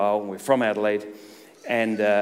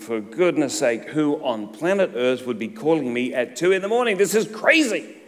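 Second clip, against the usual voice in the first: angry grumble; 2 semitones higher than usual. Retold or imitated. Annoyed man woken by a 2am phone call, irritated rant. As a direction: grumble crossly at being woken. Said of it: "I'm so angry".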